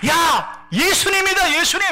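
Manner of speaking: high, loud excited shout. Acted. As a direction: cry out in joy at recognition. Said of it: "소리를 칩니다". (높고 크게 기뻐 외치는 소리)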